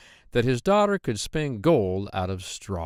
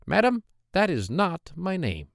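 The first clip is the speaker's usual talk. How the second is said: higher, squeaky voice of a hobbling little man; droll little man; 8 semitones higher than usual. Acted. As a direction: pitch him up high and sly. Dwarf refuses guessed names.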